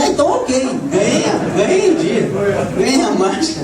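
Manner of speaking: high, laughing triumph; retold joy with a laugh; delighted. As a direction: crow over winning the day. His own delighted reply.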